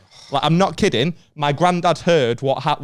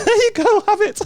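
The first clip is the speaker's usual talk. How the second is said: much higher, louder, breezy handover; mock cheerful surrender; 5 dB louder than usual. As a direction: hand it over like it's nothing, hammed-up.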